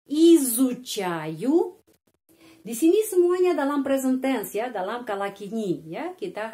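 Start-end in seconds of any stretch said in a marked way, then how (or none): 0.1-1.8 изучаю stretched very slowly and louder, rising at the end; very slow emphatic model. Shown as a demonstration. Teacher slowly modelling изучаю for learners. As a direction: draw out изучаю for the class. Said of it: "kalau belajar bahasa".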